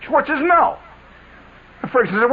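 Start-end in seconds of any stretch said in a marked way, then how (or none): 0.4-0.8 defiant no. Retold or imitated. Quarrel in the car.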